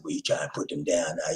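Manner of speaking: whispered mock listener objection; whispering what listeners might say. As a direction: breathe it low as an imagined critic. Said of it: "don't think y'all said".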